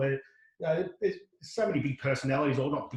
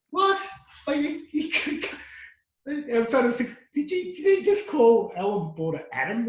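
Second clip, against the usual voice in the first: incredulous; 9 semitones higher, 3 dB louder, slower than usual. Retold or imitated. Retold reaction to commentator blunder.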